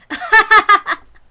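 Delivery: high, loud embarrassed laugh; Tomitake's loud flustered laugh. Acted. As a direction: laugh it off in a panic.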